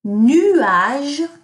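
Nuage called out loud and high for the class; teacher projecting Nuage to pupils; loud model word. Shown as a demonstration. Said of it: "I'm gonna say each of these words".

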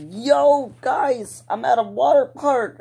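excited YouTuber voice talking into his phone; hyped vlogger. Pretend. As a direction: pitch it up like a vlogger filming himself. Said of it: "like I'm recording".

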